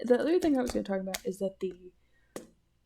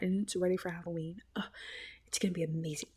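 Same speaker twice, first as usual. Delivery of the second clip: 1 whisper; 2 semitones lower, 7 dB quieter, faster than usual. Real, not acted. Excited secret aside.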